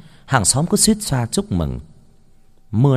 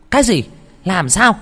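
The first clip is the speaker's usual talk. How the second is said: startled shout; 10 semitones higher, 6 dB louder than usual. Acted. Mother startled by son's call.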